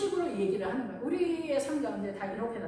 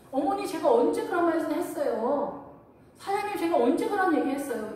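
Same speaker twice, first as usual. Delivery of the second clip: louder, higher protest of innocence; indignant protest; 6 semitones higher, 5 dB louder than usual. Retold or imitated. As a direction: defend yourself, wronged. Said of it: "이런 말 못하게 되잖아요".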